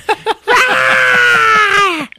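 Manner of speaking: long, high, loud mock scream; witch's death scream. Witch stabbed with sword, imitated death scream. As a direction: shriek like a stabbed movie witch.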